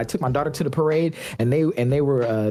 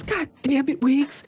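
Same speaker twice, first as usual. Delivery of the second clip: high, exasperated call-out; re-enacted exasperated call; 12 semitones higher than usual. Bench veteran calling for Wiggs, high exasperated imitation. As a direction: summon a teammate in mock annoyance. Acting Iguodala calling Wiggins over.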